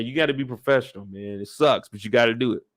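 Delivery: ordinary talk, nothing marked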